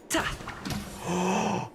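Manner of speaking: sudden high gasp of delight; sharp gasp. Real, not acted. Streamer gasping at a move he predicted. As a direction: gasp in surprise, high and breathy.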